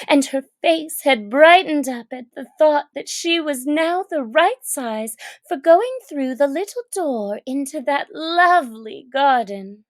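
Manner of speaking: usual speaking voice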